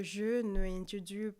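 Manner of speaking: slow Spanish in a French accent. Shown as a demonstration. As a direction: say the Spanish as a French speaker would. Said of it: "trying to do Spanish with a French accent".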